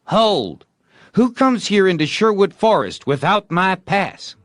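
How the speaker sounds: high, loud outlaw challenge; challenging call. A narrator's reading. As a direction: stop the stranger in the forest. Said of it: "Tom called".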